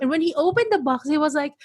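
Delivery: ordinary delivery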